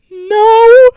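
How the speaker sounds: loud, high, drawn-out no; emphatic denial. Retold or imitated. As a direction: blurt it high and loud for laughs. Retold answer to driver's question.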